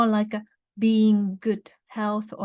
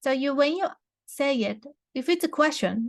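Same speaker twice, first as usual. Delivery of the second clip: usual speaking voice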